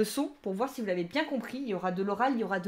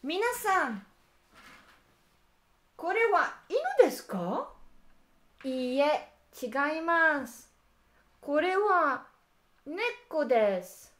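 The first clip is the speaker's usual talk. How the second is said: higher, slow, clearly voiced teacher role; acted classroom dialogue; 5 semitones higher, 4 dB louder, slower than usual. Teacher acting out a question-and-answer dialogue, higher voice. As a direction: play a teacher quizzing the class.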